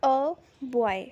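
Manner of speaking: "अ बॉय" drawn out slowly, with "अ" as a short uh; slow model example. Shown as a demonstration. Teacher slowly models "अ बॉय" for students. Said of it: "first है".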